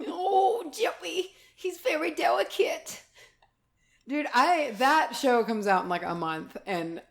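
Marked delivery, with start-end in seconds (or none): 0.1-3.0 high, precious mock voice; mock dainty voice. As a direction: play it dainty and fussy. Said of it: "You're like".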